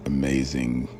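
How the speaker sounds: normal talking voice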